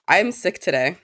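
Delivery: ordinary talk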